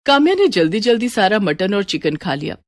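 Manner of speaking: plain reading voice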